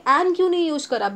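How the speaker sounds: ordinary talk, nothing marked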